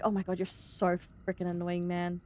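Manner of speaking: low, soft exasperated grumble; retold frustration. Worker retelling her reply to interrupting tradie, low frustrated grumble. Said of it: "it was so frustrating".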